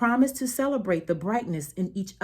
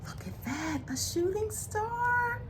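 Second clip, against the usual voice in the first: softly amazed, pointing it out to little ones; hushed wonder; 10 semitones higher, 7 dB quieter than usual. Real, not acted. Reader marvels to children.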